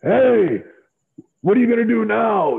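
high, loud mock yell across the room; imitating the boss shouting a challenge. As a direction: call him out loudly like an imitated boss. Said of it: "Vince yells at me".

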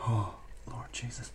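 hushed, breath-only exclamation; soft, voiceless sigh of disbelief; whispered dismay. Real, not acted. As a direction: whisper it like a horrified aside. Reacting to raw meat spread.

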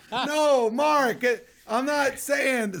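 flustered, high-pitched backpedalling; squealed mock denial; high-pitched protest. Backpedalling to offended coworker, retold.

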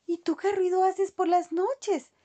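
pregunta aguda de ratita; pregúntalo con voz de ratón. A narrator's reading. (ratita al cerdo, con voz aguda)

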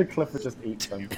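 normal talking voice

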